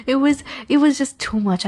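usual speaking voice

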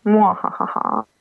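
slow, hammed-up evil cackle; slow theatrical villain menace. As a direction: mwahaha like a cartoon villain. Playful joke about controlling everyone.

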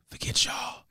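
whispered. Retold or imitated. Defiant quote.

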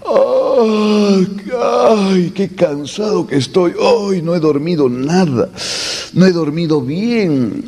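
whiny moaning "ay", dragged-out sighs; mock tired complaining. Profesor imita a quien se levanta quejándose, cansado. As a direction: groan like someone who just woke up exhausted. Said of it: "cuando se levantan temprano y dicen".

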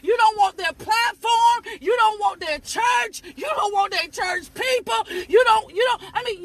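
normal talking voice

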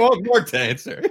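high, laughing teasing imitation; laughing mock quote. Teasing his co-host with a laughing mock question. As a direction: giggle through the mock question.

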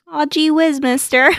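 put-on high, bright golly-gee boy; wholesome 1950s kid. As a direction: play a corny old-time kid. Mocking kid's voice.

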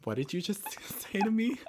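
high, half-whispered retold disbelief; mock disbelief. Friend reacting to the job title, in mock disbelief. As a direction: act out a stunned, giggly question. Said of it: "And I'm like".

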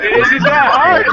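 slow, high, giggling question; laughing. Real, not acted. Teasing the rival about apologizing, laughing. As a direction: tease him through a laugh.

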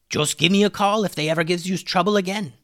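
high-pitched put-on voice; high storybook-figure voice; friendly cartoon character. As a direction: offer help in a high character voice.

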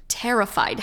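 usual speaking voice

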